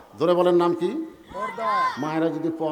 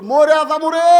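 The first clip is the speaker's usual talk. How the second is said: high, loud drawn-out cry; mock wail; 8 semitones higher, 8 dB louder, slower than usual. Veiled mother in labour cries out, high mock wail. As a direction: cry out as if in agony, hammed-up.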